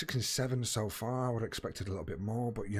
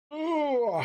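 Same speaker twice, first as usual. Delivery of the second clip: yawning; 4 dB louder than usual. Real, not acted. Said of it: "I can't stop yawning".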